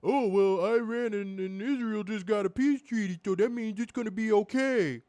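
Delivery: sing-song mock calm; mocking naive voice. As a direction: parody the clueless optimists. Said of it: "all the people going".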